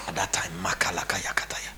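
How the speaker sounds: whispered nonsense prayer; whispered tongues. Pretend. Pastor mocking a dawn prayer, whispered. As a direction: murmur it under the breath.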